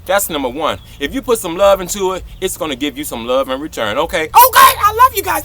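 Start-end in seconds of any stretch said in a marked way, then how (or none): 4.2-5.4 gushing up high; high loud affection. Real, not acted. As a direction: squeal the love.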